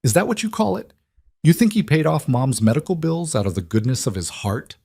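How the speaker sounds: high, bitter scorn. Acted. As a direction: spit it out with bitter disbelief. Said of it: "Maya laughed bitterly".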